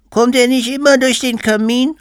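put-on very high, loud little-kid voice; child's question. A narrator's reading. Child asks parents about Santa, high loud kid voice. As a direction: pipe up like a small child. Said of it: "fragte das Kind".

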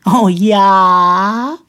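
high, loud, drawn-out rising squeal; delighted squeal. A narrator's reading. Simpel remembering the broken watch, delighted squeal. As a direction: squeal it with childlike rapture. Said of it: "quietschte Simpel und strahlte verzückt".

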